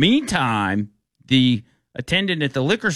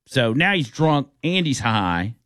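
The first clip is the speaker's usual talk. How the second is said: normal talking voice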